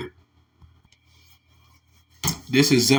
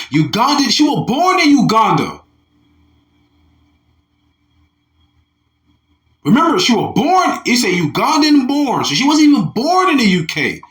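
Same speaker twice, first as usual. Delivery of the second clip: higher and louder, heating up with indignation; rising outrage as the words pile up; getting upset; 9 semitones higher, 3 dB louder than usual. Real, not acted. Preacher getting worked up over the nurse's story, high and loud. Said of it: "now I'm getting upset".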